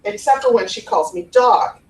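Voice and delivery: normal talking voice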